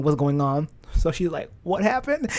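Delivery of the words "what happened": high-pitched questioning mom; mom's concerned question. Retold or imitated. Mom to her crying child on the phone, concerned question. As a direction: imitate a mother asking through the fuss.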